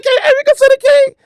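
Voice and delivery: very high, light voice of God; lofty falsetto question. Acted. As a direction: play God asking in a high, airy voice. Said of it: "God might be like".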